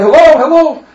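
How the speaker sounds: high, loud repeated hello; yelling into phone. Acted. Groom to bride who has stopped answering, yelling. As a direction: shout down a dead line for an answer. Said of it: "I'm yelling, I'm shouting".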